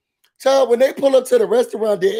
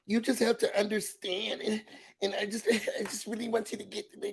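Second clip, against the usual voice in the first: much softer imitated pleading; soft rambling plea; 12 dB quieter than usual. Pleading to be understood on the phone, soft. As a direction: ramble softly, trying to explain.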